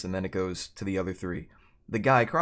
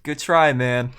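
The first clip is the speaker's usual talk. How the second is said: loud, higher mock consolation; smug grin; 3 semitones higher, 8 dB louder, slower than usual. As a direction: gloat through a big grin. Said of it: "this huge shit-eating grin".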